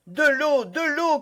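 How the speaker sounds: desperate cry for water. Acted. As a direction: shout for water urgently. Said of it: "criait D'Artagnan".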